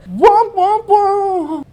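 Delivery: high, loud mock trombone wah-wah; sad trombone noise. Recapper after everybody gets word, sad trombone noise. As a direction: imitate a comic losing horn, dragging it out.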